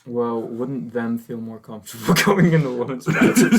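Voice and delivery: laughing through the question; words breaking into real laughter. Real, not acted. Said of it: "Sorry, I was trying not to laugh".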